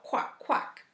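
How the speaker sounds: ordinary delivery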